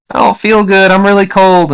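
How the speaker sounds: put-on high-pitched child's voice; sick kid. Sick kid in the corner to mother, high child voice. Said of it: "kid in the corner starts to cough and say".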